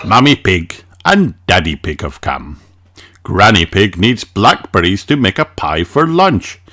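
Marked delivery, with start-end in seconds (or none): none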